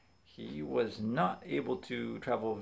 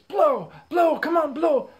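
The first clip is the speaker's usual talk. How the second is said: very high, loud mom shouting orders; imitated urgent shouting; 14 dB louder than usual. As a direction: yell it urgently, playing a frantic mom. Mom urging child to blow nose.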